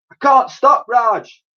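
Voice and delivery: high loud hurried reply; called back. Acted. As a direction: shout it over your shoulder. Dotty calling back.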